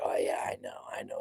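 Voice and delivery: breathy throwaway whisper, barely voiced; dismissive whisper. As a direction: brush it off under your breath. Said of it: "Tom's like".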